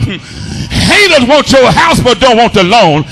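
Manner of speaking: driving, rhythmic sermon delivery; full-force preaching. Real, not acted. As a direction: preach hard, stretching key words into shouts.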